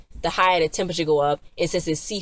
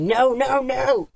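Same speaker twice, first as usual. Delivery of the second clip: desperate high-pitched no, no, no; alarmed no; 8 semitones higher, much slower than usual. Retold or imitated. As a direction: beg her to stop, rising in alarm. Tommy protests in alarm.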